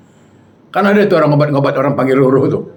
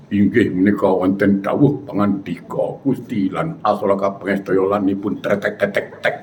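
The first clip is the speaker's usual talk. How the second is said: mock-solemn puppet-show narration in a lowered voice; wayang kulit puppeteer; 7 semitones lower, 5 dB quieter than usual. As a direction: imitate a shadow-puppet master intoning, with rattled sound effects. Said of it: "jadi wayang kulit".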